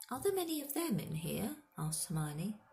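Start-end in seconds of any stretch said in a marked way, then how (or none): 0.1-1.6 put-on high-pitched young girl; girl's question. A narrator's reading. Hermione asks Hagrid about centaurs, high girlish question. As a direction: lift into a curious girl's voice.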